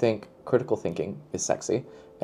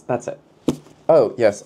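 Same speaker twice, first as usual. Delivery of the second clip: usual speaking voice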